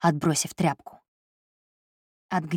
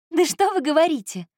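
high amused mock surprise; 11 semitones higher than usual. A narrator's reading. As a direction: tease him, playfully high. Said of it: "развеселилась Эльза".

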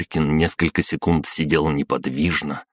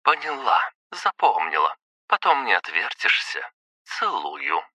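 flirty wife; 3 semitones higher than usual. Acted.

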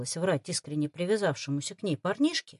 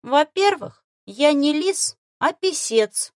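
higher, louder matter-of-fact; businesslike correction; 7 semitones higher, 6 dB louder, slower than usual. Acted. Fox corrects the boy, businesslike. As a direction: set the boy straight. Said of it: "деловито поправил зверёк".

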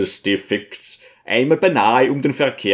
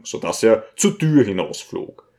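ordinary delivery, nothing marked

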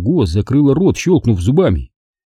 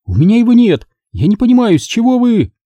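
high, louder protest; frantic denial; 12 semitones higher, 3 dB louder, faster than usual. A narrator's reading. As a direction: deny it desperately. Margo's denial.

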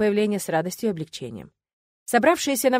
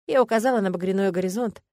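usual speaking voice

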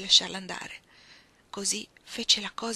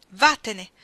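loud, curt order to leave; angry dismissal; 11 dB louder than usual. Acted. Iggy to Ruth through the closed door, angry. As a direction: send her away angrily.

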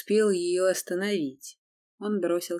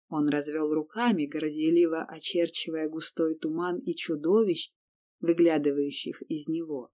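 ordinary delivery

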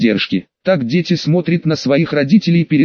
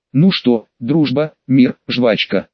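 ordinary delivery, nothing marked

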